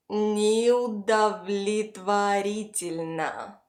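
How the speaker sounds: Неудовлетворительно very slowly, syllable by syllable; slow pronunciation model. Shown as a demonstration. Teacher models a long word for repetition, very slowly. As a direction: stretch out Неудовлетворительно so learners can follow. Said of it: "Repeat after me".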